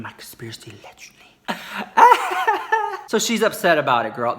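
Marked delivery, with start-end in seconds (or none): 0.0-1.3 hushed aside. Real, not acted. Telling viewers a rumor, hushed whisper. Conspiratorial aside, don't quote me. 1.5-3.0 squealing high laugh; high burst of laughter. Real, not acted. Laughing at her own rumor, high cackle.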